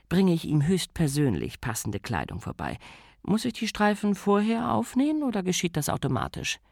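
serene aristocratic command; Lady Sybil voice. Acted.

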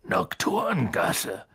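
slow, drawn-out giant's rumble; Hagrid growling. A narrator's reading. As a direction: grumble NOCTURNGASSE out slowly. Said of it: "brummte Hagrid".

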